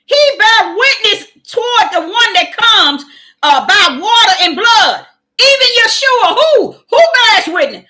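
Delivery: loud, high fervent preaching; shouted call-and-response sermon. Acted. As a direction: preach it out with full force. Preaching at full force, loud.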